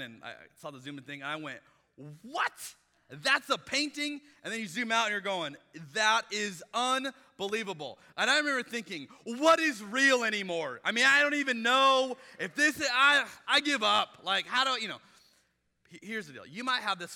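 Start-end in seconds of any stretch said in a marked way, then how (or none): 2.2-4.2 high, loud "What?", then slow disbelief; amazed, higher and louder; retold shock. Retelling his shock at the photo. 5.9-8.0 loud, slow, awed; mock-amazed, louder; retold amazement. Retelling amazement at the painting. 9.2-15.0 overwhelmed, loud and higher; exasperated disbelief. Retold or imitated. Retelling his exasperated thoughts.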